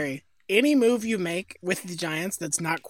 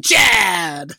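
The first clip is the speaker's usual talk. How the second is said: put-on drunk guy; 5 dB louder than usual. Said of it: "like a drunk guy saying".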